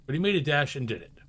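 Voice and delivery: ordinary delivery, nothing marked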